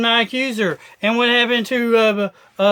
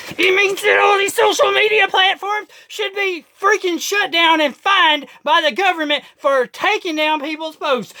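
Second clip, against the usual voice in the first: high, shouted rant; heated shout; 8 semitones higher than usual. Real, not acted. Ranting to viewers about platforms, shouting. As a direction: yell it out in outrage.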